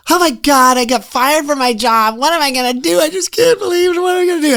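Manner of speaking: high, whiny mock panic over a lost job; hammed-up high wail of despair; panicked newcomer. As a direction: play the frantic guy who just got fired. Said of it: "and they'd go".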